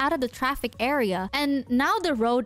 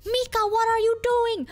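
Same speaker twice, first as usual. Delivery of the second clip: panicked urging; 9 semitones higher than usual. Retold or imitated. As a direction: urge the driver to flee, high and stretched. Roomie panicking during the road scare.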